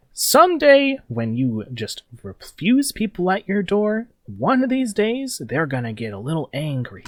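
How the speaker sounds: high, slow veiled threat; menacing gang leader. Acted. Gang leader threatens the defenders, high slow menace. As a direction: warn them sweetly of what's coming. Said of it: "He's like".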